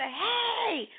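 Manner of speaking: high, drawn-out mock call; acted distant holler. Spouse drifting further and further off, acted hollering. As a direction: call after someone getting further away. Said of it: "he gets further and further".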